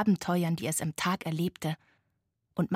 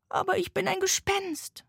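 high-pitched little ghost voice; 11 semitones higher than usual. A narrator's reading. Tired ghost objecting to the sloth, high voice. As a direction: protest in a small, high voice. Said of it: "sagte das Gespenst".

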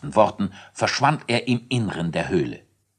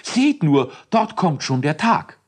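excited; 8 semitones higher, 4 dB louder than usual. Acted. Elisius points out the day.

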